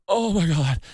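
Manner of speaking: high laughing moan of relief; relieved moan. Retold or imitated. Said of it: "you're moaning over it".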